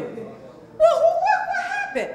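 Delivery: mock shock. Coach acts out a person caught off guard, high mock panic. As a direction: play the startled victim, way up high.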